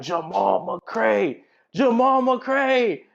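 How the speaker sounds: high, slow, loud calling out of a name; calling his name out across the restaurant. Retold or imitated. Woman recognising him in a restaurant, calling out loud and high. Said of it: "she starts getting louder".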